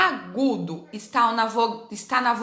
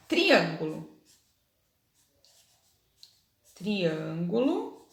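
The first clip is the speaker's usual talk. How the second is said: Triângulo drawn out slowly, stressed syllable marked; 4 semitones lower, 4 dB quieter, slower than usual. Shown as a demonstration. Teacher modelling the stressed syllable for pupils. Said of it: "a sílaba que tem o acento".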